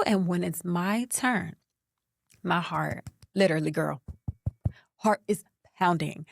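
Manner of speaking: ordinary delivery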